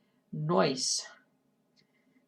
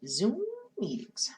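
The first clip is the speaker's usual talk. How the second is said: slow whisper; 5 semitones higher, slower than usual. Real, not acted. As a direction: muse in a whisper. Whispered musing.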